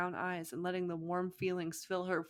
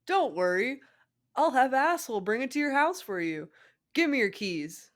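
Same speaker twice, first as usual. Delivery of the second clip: put-on loud, higher brash boy; brash Naruto; 7 semitones higher, 8 dB louder than usual. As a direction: boss the problem away loudly.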